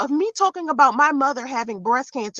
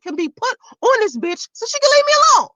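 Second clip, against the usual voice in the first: higher, quick, fed up; exasperated; 8 semitones higher, faster than usual. Real, not acted. Vlogger fed up with Valerie harassing her, quick exasperated rant. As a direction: spit it out fed up. Venting at the troll.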